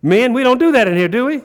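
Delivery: high, emphatic preacher challenge; fired-up preaching. Acted. Preacher telling men not to act immature, fired-up preaching. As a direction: press the point on the congregation.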